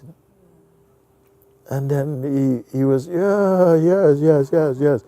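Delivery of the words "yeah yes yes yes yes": much higher eager yeses; eager agreement. Retold or imitated. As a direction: warm up to him all at once. Old man suddenly agreeing eagerly.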